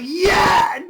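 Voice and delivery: high, loud cheering yell; excited shout. Real, not acted. Fan cheering the scene with a real shout. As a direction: cheer the fireball at full volume. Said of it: "I was like".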